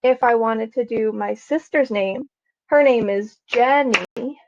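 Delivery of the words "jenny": Jenny stretched into two beats, Jen-ny; syllable by syllable. Shown as a demonstration. Teacher using her sister's name, Jenny syllable by syllable. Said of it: "She has two syllables".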